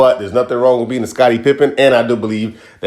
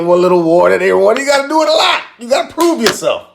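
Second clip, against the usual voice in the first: mock lecture; 6 semitones higher than usual. Teasing about earning a knighthood.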